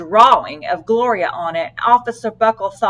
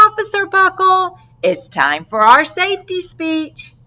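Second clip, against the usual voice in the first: higher, chirpy principal; cheery principal; 10 semitones higher than usual. Acted. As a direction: sing it out sweetly on the phone. Principal voiced on phone.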